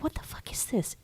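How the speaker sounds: hushed unvoiced whisper of disbelief; mock whisper. Whispering to a friend about the absurd high dive. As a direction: breathe it like a shocked aside. Incredulous aside about the pool.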